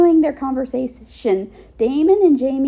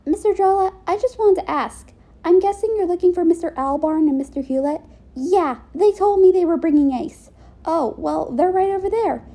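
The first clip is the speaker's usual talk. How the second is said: put-on lightly raised, eager young voice; a touch higher, friendly; higher character voice; 6 semitones higher than usual. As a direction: ask politely, voice lifted.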